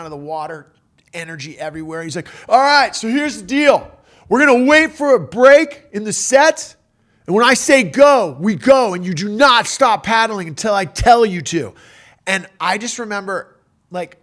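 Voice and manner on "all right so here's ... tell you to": loud, high rallying orders; imitating a surf leader's forceful commands; commanding shout. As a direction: bark it out like a coach before the big push. Herbie giving orders before paddling out.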